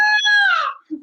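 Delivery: high-pitched mock shriek; imitated king yelp. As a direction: yelp like a startled wrestling commentator. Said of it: "the king yelps".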